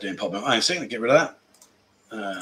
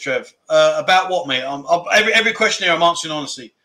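usual speaking voice

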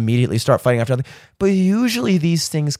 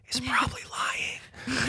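whispering; 9 semitones higher, 15 dB quieter, slower than usual. Acted. Whispered spoiler about the government.

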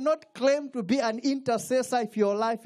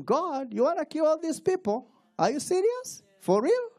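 incredulous Moses; 9 semitones higher than usual. Retold or imitated. Moses arguing with God.

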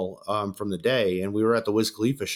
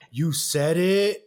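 slow, stretched-out taunt with raised pitch; gloating taunt; 4 semitones higher, much slower than usual. Retold or imitated. Customer catching out the bouncer, drawn-out taunt. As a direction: draw each word out mockingly.

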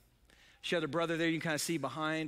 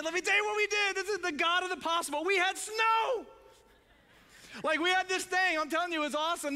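high, loud excited burst; 12 semitones higher, 4 dB louder than usual. Real, not acted. Pastor thrilled telling the church about the snow. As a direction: pitch soars with delight over the snow.

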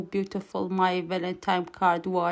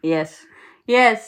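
trying not to laugh; 2 semitones higher, 7 dB louder than usual. Real, not acted. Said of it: "why do you just keep saying".

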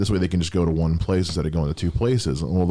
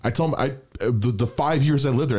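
usual speaking voice

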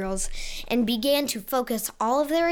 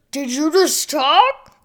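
grotesque monster voice; 3 semitones higher, 5 dB louder, slower than usual. Acted. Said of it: "another piped in".

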